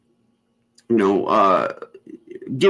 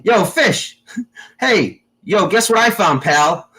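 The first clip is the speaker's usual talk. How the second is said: teasing call-out; 6 semitones higher, slower than usual. Retold or imitated. Calling out to Fish, teasing.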